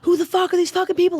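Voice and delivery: high, loud screamed outrage; retold furious scream. Comic reliving her screaming at her dad outside the theater. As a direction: yell it like you've lost it. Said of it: "I was screaming outside the theater".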